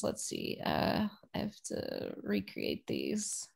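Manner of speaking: whispered aside. Real, not acted. Talking to herself setting rooms.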